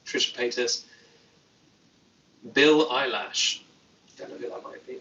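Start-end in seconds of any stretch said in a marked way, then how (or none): 4.2-5.0 whispered aside. Real, not acted. Muttered aside to himself.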